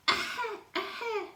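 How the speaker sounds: a tiny flea coughing twice; flea's little cough. Acted. Flea coughing in the night, high tiny cough. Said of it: "No one heard him cough".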